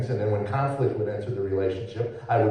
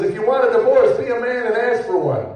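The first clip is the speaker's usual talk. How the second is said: sharp, higher mock wife line; wife's cutting reply; 10 semitones higher, 4 dB louder than usual. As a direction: throw the challenge back at him, higher.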